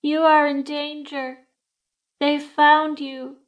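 put-on a young woman's voice, high, slow and emotionless; flat chilling voice. A narrator's reading. Spirit warning the séance guests, flat and chilling. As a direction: speak like a cold spirit through a medium. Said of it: "a young woman's voice, unemotional, flat, chilling".

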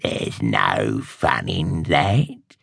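low, slow contemptuous sneer. Acted. Old witch scorning shallow magic, slow low sneer. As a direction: drag the words out with scorn. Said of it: "she sneered".

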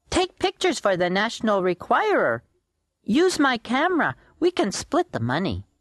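put-on high, eager kid voice; D W, scheming child. D W telling the boys to take pictures, scheming. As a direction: pitch the money-making plan as a child.

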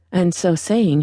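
usual speaking voice

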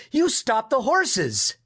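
high, slowed exclamation; excited shout. A narrator's reading. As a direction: exclaim it high to grandfather, drawing it out. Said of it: "Lewis shouted".

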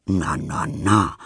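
low soothing grumble; a hint of a grumbling granny; grumbling grandma. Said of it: "brummelt Oma Augustine".

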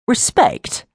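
incredulous. Acted. Incredulous retort.

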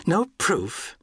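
high-pitched, disbelieving echo; incredulous question. A narrator's reading. Englishwoman challenging the ranger's denial, incredulous. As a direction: throw "No proof" back high, as a question. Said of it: "gave Sam a curious look".

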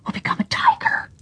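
eerie unvoiced whisper of the spring; spring's whisper. A narrator's reading. Enchanted spring warns the children in a whisper. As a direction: breathe it like a magic voice from the water.

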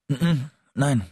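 stammered, high, startled no; caught-out stutter; nervous stammer. A narrator's reading. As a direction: stumble into the denial in a panic. Boy nervously denies, swallowing.

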